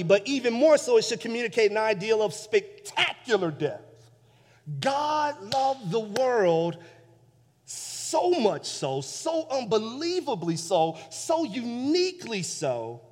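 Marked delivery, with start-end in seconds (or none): 4.8-13.0 slow, each "so" weighted and drawn out; stress on so. Shown as a demonstration. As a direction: let every "so" sink in slowly. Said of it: "The word "so".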